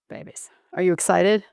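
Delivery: ordinary delivery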